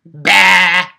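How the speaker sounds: loud mocking sheep bleat.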